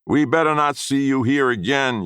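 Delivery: high, loud shouted warning; yelling threat. A narrator's reading. Ding yelling after the boy he chased off, loud. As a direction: yell it after the fleeing boy. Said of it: "yelled Ding".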